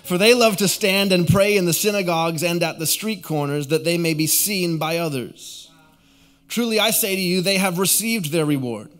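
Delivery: lifted, emphatic Bible reading; scripture reading. Real, not acted. Pastor reading Jesus' warning about hypocrites, proclaimed scripture reading. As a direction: proclaim the verses to the congregation. Reads scripture aloud to congregation.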